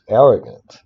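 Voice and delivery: usual speaking voice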